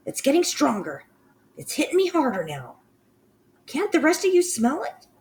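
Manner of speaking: high, eager witch voice climbing; excited witch. Acted. Mildred urges the others to smell, high and eager.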